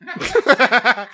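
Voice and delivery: a loud, high burst of real laughter. Real, not acted. Laughing out loud at his own podcast joke. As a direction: crack up at your own joke.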